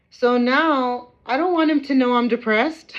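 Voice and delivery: high and loud, near tears; upset outburst. Real, not acted. Mother venting about the CPS worker, loud and upset. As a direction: force it out, raw and hurt. Upset rant, later repeated low.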